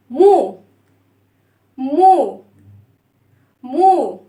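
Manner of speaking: loud, separate "مو" with long oo; rounded damma vowel. Shown as a demonstration. Teacher telling children to listen, rounded damma vowel. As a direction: round the lips firmly on each "مو". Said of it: "هنضم الصوت ازاي؟ اسمعوا كده".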